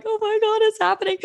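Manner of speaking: imitated high-pitched tearful hyperventilating; hysterical bawling. Telling how the email hit her, mock hysterical bawling. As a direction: re-enact sobbing in squeaky, overwhelmed disbelief. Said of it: "Hysterical, like bawling, hyperventilating".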